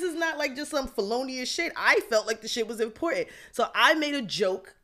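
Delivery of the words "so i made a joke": ordinary talk, nothing marked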